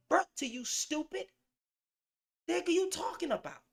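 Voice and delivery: exasperated yelling. Acted. To the panel woman who doesn't know biology, exasperated yelling. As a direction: chew her out in disbelief.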